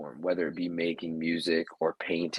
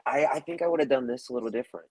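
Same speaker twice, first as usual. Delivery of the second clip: quoted critic; 6 semitones higher, faster than usual. Pretend. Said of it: "watch my match to say".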